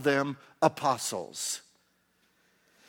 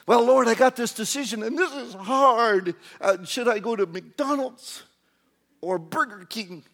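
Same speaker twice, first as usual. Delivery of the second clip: raised, hammed-up plea over a trivial choice; mock anguished prayer; 5 semitones higher, 4 dB louder than usual. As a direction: agonize aloud to the Lord, easing off at the end. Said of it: "Can you hear yourself do this".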